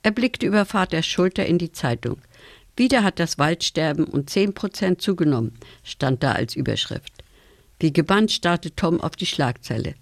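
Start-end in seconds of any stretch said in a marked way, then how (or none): none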